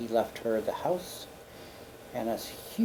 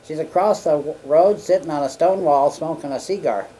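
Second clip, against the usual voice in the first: secretary calls it out higher and louder; loud secretary report; 5 semitones higher, 10 dB louder than usual. Retold or imitated. Secretary tells the president where his sister is, loudly. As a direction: announce the scene loudly and a bit scandalised. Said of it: "secretary reports her whereabouts".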